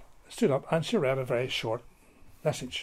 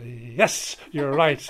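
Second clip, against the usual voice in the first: triumphant confirmation; 10 dB louder, much slower than usual. Retold or imitated. Principal confirms the April fool.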